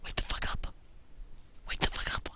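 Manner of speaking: hoarse urgent whisper; character passing out, pleading whisper; desperate whisper. Real, not acted. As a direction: hiss it through the panic.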